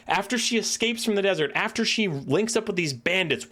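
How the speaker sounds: ordinary delivery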